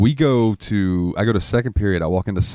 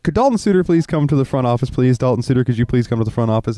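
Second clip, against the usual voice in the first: higher, formal mock intercom page; intercom announcement; 6 semitones higher than usual. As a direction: call the student to the office over the PA.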